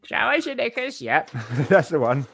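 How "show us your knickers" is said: high cheeky parrot squawk. Pretend. Imitating rude parrot.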